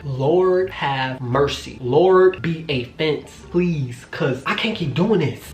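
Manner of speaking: slow, drawn-out plea to the Lord; weary prayer. Retold or imitated. Woman praying as her boyfriend hovers over her, weary plea. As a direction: mock-desperate prayer, stretching the words.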